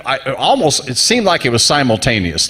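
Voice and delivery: ordinary talk, nothing marked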